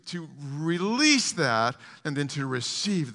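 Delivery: building from loud to a very high, loud cry; preaching at full force. Acted. Preacher urging the congregation to confess, rising to a shout. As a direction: climb until you are shouting the confession.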